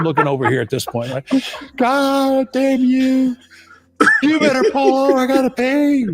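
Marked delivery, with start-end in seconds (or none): 1.8-6.1 very high, drawn-out mock curse; exasperated passenger. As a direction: wail it as an imitated man at breaking point. Passenger cursing driver in desperation.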